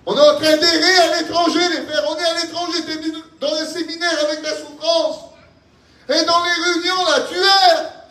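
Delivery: high, ringing preacher's cry; full-force preaching. Real, not acted. Preacher crying out to brothers lost in suffering, full force. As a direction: cry it out to the whole congregation.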